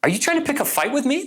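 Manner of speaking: high-pitched, rising incredulous question; incredulous challenge. Retold or imitated. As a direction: challenge them in mock disbelief, pitch way up. Acted challenge to her reply.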